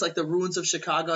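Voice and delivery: ordinary talk, nothing marked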